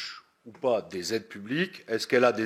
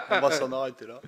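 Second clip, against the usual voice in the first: laughing; 2 semitones higher, faster than usual. Real, not acted. Joking that the hearing is over.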